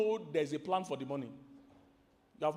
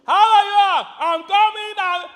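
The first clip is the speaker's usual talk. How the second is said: friendly greeting yelled far louder and higher; everyday yelling; 17 dB louder, slower than usual. Retold or imitated. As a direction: yell it cheerfully like a loud household that always shouts. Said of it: "Everybody yells".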